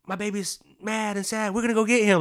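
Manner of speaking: high, rising to loud, hammed-up grandma fuss; doting grandparents. Grandparents rushing over to their grandson, high and loud. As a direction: play the grandparents all worked up to come get him. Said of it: "my other grandparents were like".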